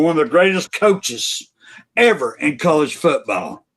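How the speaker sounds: normal talking voice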